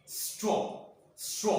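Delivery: "स्ट्रॉ" with a lengthened s leading into ट्रॉ; teacher joining the two sounds of "स्ट्रॉ"; sounded out. Shown as a demonstration. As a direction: blend a held sss into ट्रॉ for "स्ट्रॉ". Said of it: "हमारा पहला sound आ रहा है स".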